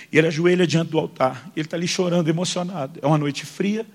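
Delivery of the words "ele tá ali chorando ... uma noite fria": ordinary talk, nothing marked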